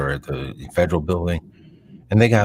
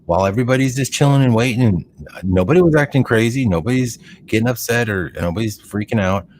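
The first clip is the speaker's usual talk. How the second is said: usual speaking voice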